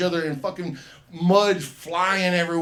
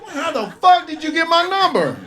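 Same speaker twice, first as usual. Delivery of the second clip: much higher, louder, laughing through it; freaked-out laughing yell; 11 dB louder than usual. Retold or imitated. Comic yelling at the boy who broke into the call, laughing. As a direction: yell it, spooked and cracking up. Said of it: "the little boy's like".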